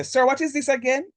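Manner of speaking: high, confused student question, mocked. Teacher mimics a student asking what it means, mock confusion. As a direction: ask it like a puzzled pupil, lightly mocked. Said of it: "don't say".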